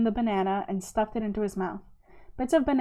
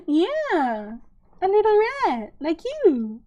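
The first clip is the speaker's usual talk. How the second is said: cooing pet talk; 8 semitones higher, 4 dB louder, much slower than usual. Real, not acted. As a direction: coo adoringly to a little pet. Coos at a pet rat.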